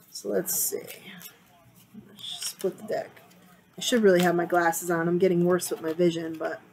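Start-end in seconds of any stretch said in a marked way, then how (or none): 0.1-3.2 whisper. Real, not acted. Whispering to herself at the deck.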